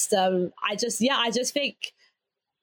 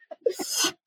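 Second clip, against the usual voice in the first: laughter; 4 dB quieter than usual. Real, not acted. Laughing after the retold shout.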